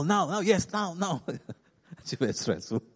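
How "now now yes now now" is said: raised pitch, insistent repeated nows; mock-stressed nagging for dinner to start; urgent hurrying. Harried husband urging his wife to start dinner, urgent.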